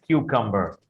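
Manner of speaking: क्यूकम्बर said as "kyoo-kum-ber"; सही उच्चारण: क्यूकम्बर; correct pronunciation. Shown as a demonstration. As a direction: model क्यूकम्बर once, cleanly. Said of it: "बजाय कुकुम्बर के आपको इसको क्यूकम्बर बोलना है".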